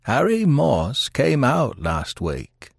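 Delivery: terse, measured insistence; curt. A narrator's reading. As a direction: restate it firmly and slowly. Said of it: "continued the detective shortly".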